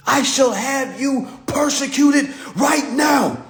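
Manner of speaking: high, drawn-out theatrical outrage; Harry Potter character. Pretend. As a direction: proclaim it slowly like an offended fantasy noble. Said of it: "talk like somebody from Harry Potter".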